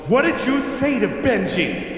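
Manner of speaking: high, slow, scolding demand; imitated furious mother. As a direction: confront a child as an angry mom. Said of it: "she's furious".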